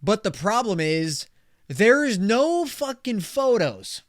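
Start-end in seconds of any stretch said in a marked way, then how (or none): 1.7-4.0 exasperated. Real, not acted. Ranting about listings without photos.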